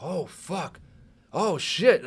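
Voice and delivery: high, acted-out astonishment; two high-pitched bursts of amazement; stunned realization. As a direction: relive the shock of being right. Reliving moment it happened as predicted.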